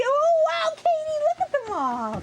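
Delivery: high, loud delighted cry. Real, not acted. Delighted at bubbles for toddler.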